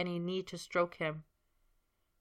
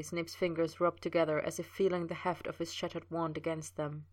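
ordinary delivery, nothing marked

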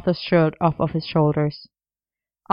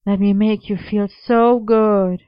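slow, loud, higher sing-song come-on; 4 semitones higher, 5 dB louder, slower than usual. A narrator's reading. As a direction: draw it out in a hammed-up singing lilt. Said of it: "continued in a sing-song voice".

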